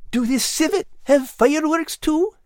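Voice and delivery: high, curious small creature voice; a small pet asking eagerly; little animal character. Acted. Al the cat speaks.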